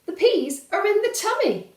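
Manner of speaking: put-on high loud triumphant kid; proud child. Valdemar proudly showing Papa his empty plate, high and loud. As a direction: show off like a boy who's cheated. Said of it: "says Valdemar".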